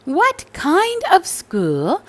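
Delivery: shop lady. Acted. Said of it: "said the lady behind the counter".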